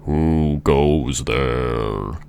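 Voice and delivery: slow, drawn-out booming giant voice; mighty voice of a looming giant. Acted. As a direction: stretch each word out like a huge giant. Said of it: "with a mighty voice".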